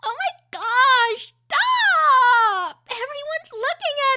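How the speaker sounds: high, loud giggling protest; teen mortified in public, laughing; embarrassed laughing. Shown as a demonstration. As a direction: laugh while begging them to stop. Second take, laughing girl.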